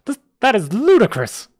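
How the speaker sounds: high, loud indignant scoff. Acted. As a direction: sneer at the price, appalled. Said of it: "the man scoffed".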